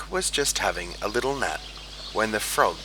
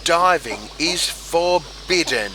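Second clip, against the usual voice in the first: higher, louder indignant telling-off; cross scolding; 7 semitones higher, 4 dB louder than usual. A narrator's reading. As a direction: scold them sternly. Duck scolding the frogs.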